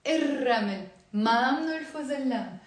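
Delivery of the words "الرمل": الرمل as ir-ramel, no L; silent lam. Shown as a demonstration. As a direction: trill a doubled R in الرمل. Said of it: "We don't pronounce the lam".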